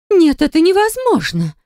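high-pitched stricken disbelief; devastated. A narrator's reading. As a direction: say it as if crushed by the news. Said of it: "убитым голосом произнесла женщина". (убитым голосом)

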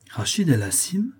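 whispered, impressed question; hint of admiration. A narrator's reading. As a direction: uncle whispering the doctor's name in awe. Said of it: "avec un soupçon d'admiration dans la voix".

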